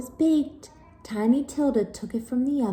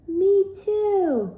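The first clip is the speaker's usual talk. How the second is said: high, loud screech; 11 semitones higher, 5 dB louder than usual. Acted. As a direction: screech it shrilly back. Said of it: "screeched Wilda".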